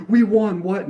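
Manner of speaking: playful imitation of a gloating fan; mock gloating. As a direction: gloat at rival fans, hammed up. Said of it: "I'm not gonna sit here and be like".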